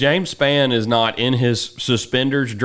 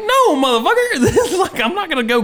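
laughing refusal. Retold or imitated. Refusing to clear the neighbour's house, laughing shout. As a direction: blurt it out through laughter. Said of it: "I'm like".

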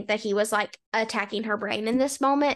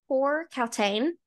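ordinary talk, nothing marked

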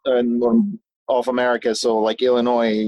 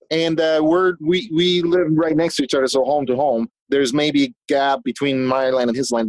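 usual speaking voice

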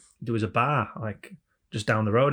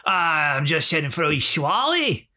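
high, slow Scottish drawl; broad Scottish accent; 5 semitones higher, 4 dB louder than usual. Retold or imitated. Frank answering the Canadian driver, thick Scottish accent. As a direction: stretch out a hammed-up Scottish reply. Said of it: "Kevin Bridges type Scottish".

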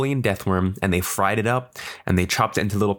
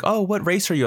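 high-pitched imitation of a customer; nosy lady; 10 semitones higher than usual. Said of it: "lady who was like".

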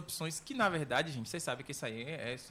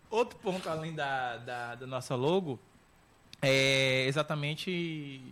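trailing off; much slower than usual. Real, not acted. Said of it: "Esqueci o que eu ia falar".

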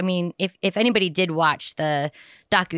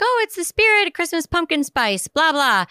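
high, chirpy holiday cheer; mock cheerful; 10 semitones higher than usual. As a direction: gush in a silly festive voice. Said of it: "you're like".

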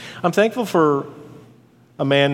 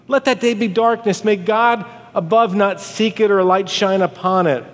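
raised-pitch, impassioned proclamation; declaimed curse; 5 semitones higher than usual. Acted. Preacher voicing Job cursing his birthday, impassioned. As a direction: pronounce a bitter curse aloud.

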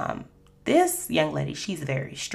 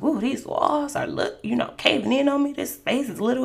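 higher, uneasy voice, walls closing in; nervous; 8 semitones higher than usual. Retold or imitated. Trapped in a tiny elevator, nervous and higher. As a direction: let the panic creep in. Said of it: "I'm getting a little nervous".

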